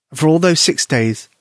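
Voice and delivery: ordinary delivery, nothing marked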